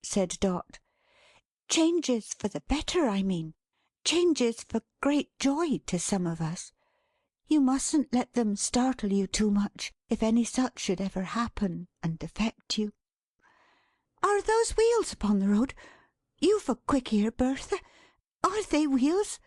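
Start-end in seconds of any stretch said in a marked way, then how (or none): 1.7-12.9 higher-pitched woman's character voice; gently hint at news in a lifted voice; Dot, kindly and hinting. Acted. 14.2-19.4 high, keyed-up character voice; Dot, anxious and expectant. Acted. Dot listens for wheels.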